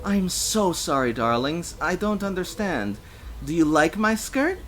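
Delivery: high, sugary mock politeness; mockingly polite. A narrator's reading. Stranger to cursing drunks after a commotion, mockingly polite. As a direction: feign a sweet apology. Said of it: "a clear, mockingly polite".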